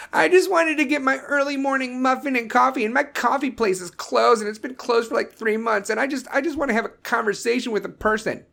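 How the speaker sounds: high whiny entitled voice; mocking Karen. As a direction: parody a spoiled complainer. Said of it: "mocking a Karen".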